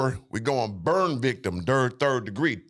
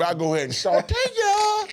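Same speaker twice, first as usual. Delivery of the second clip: high playful voice. Pretend. Flirting at overseas woman.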